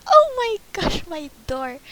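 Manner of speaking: loud, high excited outburst; overexcited loud cry; excited shout. Real, not acted. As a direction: yell it out in delight. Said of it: "Oh, I'm so loud".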